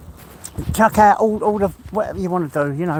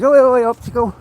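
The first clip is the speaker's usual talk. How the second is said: calling out greeting; 4 semitones higher than usual. Real, not acted. Streamer greeting a viewer who pops on, calling out. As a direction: hail a mate as they turn up.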